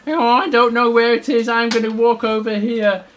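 a touch of playful uncertainty; blindfolded little bear. Acted. Blindfolded bear gropes about.

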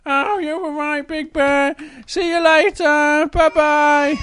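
high, loud cub calling goodbye; Little Bear. Acted. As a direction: shout farewell in a little bear voice. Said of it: "out of the window to Little Bear".

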